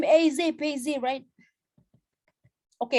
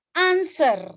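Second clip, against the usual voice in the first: American accent; 5 semitones higher, 4 dB louder than usual. Shown as a demonstration. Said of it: "itu adalah, eh, American".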